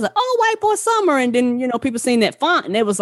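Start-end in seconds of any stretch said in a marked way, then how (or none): 0.1-1.2 mocking quote.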